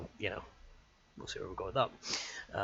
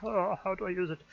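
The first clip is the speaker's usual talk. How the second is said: higher, mock-puzzled self-quote; mock confused; 9 semitones higher than usual. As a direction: act baffled by channel points. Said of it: "I'm like".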